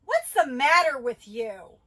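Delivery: loud, slow, high accusing shout; angry yell. Shown as a demonstration. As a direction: bark it out in anger.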